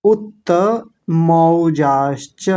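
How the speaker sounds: उत्तमौजाश्च drawn out very slowly, each syllable clear; slow recitation demo. Shown as a demonstration. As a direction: spell out उत्तमौजाश्च slowly for students. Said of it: "मो नहीं बोलेंगे, मौ बोलेंगे".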